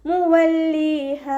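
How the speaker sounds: slow, drawn-out melodic tajweed chant; Quran recitation. Shown as a demonstration. Teacher models melodic Quran recitation for students. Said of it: "പല്ലിനെ ചുണ്ടിൽ തട്ടിക്കാതെ നോക്കാൻ".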